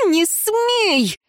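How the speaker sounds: high furious cry; яростный рык; growled. A narrator's reading. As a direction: snarl it at him in fury. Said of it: "зарычала Лейра".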